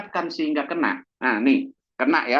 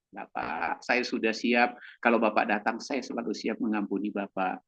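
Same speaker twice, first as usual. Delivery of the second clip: calm reply, forgiving the shouting man; 7 semitones lower, 5 dB quieter than usual. Acted. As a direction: lower and softer, gentle reply.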